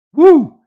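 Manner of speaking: loud, high-pitched "woo!"; short piercing hype yell; excited whoop. Real, not acted. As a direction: let out a big celebratory whoop. Hype whoop while bragging.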